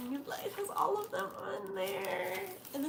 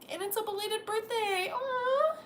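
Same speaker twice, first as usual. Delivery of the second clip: touched; 9 semitones higher, 3 dB louder than usual. Real, not acted. Touched by birthday letter.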